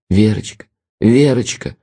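ordinary delivery, nothing marked

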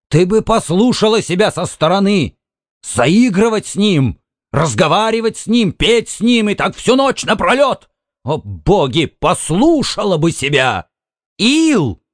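high-pitched scolding husband. A narrator's reading. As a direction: scold her, pitch climbing to a cry. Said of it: "не повышай голос". (гневно, на повышенных тонах)

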